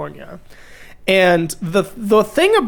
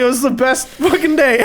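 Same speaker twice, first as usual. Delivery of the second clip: usual speaking voice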